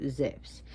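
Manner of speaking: Zeus said "zevs"; Greek-style Zeus, short e then v-s. Shown as a demonstration. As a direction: voice the u in Zeus as a consonant, "zefs". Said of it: "The U is actually like a V".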